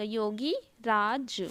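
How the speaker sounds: Yogi राज slowly, "yogi... raaj"; broken into parts. Shown as a demonstration. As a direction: dictate Yogi राज with a gap between the parts. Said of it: "छोटी की मात्रा हो जाएगा".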